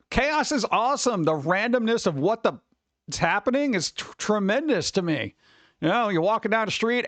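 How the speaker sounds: usual speaking voice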